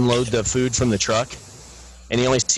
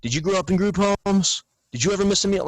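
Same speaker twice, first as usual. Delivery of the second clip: imitated movie yell; 9 semitones higher, faster than usual. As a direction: mimic his intense challenge, pitched well up without full volume. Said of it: "and he yells".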